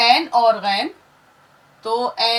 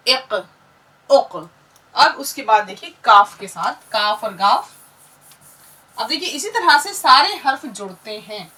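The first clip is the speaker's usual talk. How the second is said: ordinary delivery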